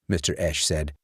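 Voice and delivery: ordinary delivery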